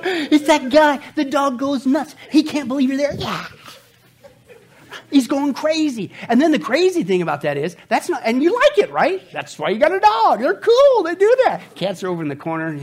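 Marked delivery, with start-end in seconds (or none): 0.1-11.6 high, loud and gleeful, like the dog just saw you; excited dog greeting. Acted. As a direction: burst with joy, higher and louder.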